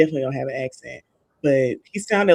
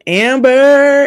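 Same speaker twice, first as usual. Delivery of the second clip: high, loud, drawn-out mock call of a name; dramatic exclamation; 10 semitones higher, 11 dB louder than usual. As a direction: belt the name out, hammed-up and dramatic. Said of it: "It's so dramatic".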